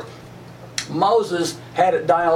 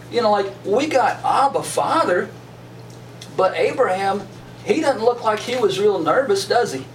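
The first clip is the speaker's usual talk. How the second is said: ordinary talk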